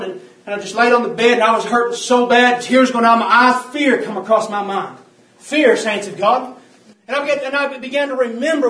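loud, urgent testimony; preaching at full force. Acted. Preacher recalling pain and fear, preaching at full force. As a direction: push the pain and fear out to the church.

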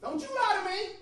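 high-pitched stern warning; acting out a woman who won't be fooled. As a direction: warn him off, pitch climbing with each demand.

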